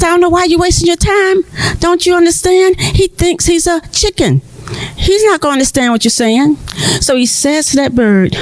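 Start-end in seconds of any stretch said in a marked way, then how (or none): 0.0-6.6 higher, fast dismissive farmer; scoffing farmer. Acted. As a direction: rattle it off higher, mocking the effort.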